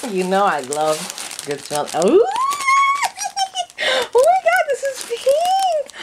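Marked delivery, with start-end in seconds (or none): 2.0-5.9 high, laughing shriek of delight over the colour; excited squeal. Real, not acted. Unboxing surprise, pink fabric. 6.0-6.0 surprised gasp. Real, not acted. Gasp at the unboxing.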